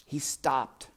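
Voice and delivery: ordinary delivery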